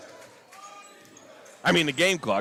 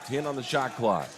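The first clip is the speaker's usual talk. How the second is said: normal talking voice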